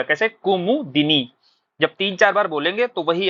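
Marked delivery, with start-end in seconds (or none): 0.3-1.3 slow ku-mu-di-ni for कुमुदिनी; clear कुमुदिनी. Shown as a demonstration. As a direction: say कुमुदिनी slowly, sounding di. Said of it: "clear एकदम बोलिएगा". (कुमुदिनी, धीरे और साफ़)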